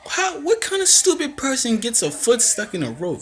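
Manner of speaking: very high-pitched exasperated disbelief; hammed-up baffled outburst; incredulous. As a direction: squeak out your disbelief at the blunder. Acting out own disbelief at mishap.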